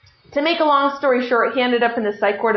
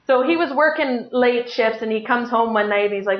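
ordinary talk, nothing marked

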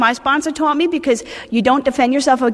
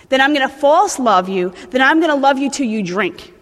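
ordinary talk

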